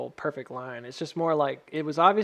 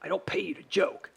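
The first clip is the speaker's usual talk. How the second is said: a touch of a mock stern boss; hint of a grumpy boss insisting; bossy alter ego; 3 semitones higher than usual. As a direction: repeat the scolding in a lightly put-on voice. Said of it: "That's not the point, man".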